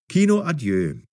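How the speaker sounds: ordinary delivery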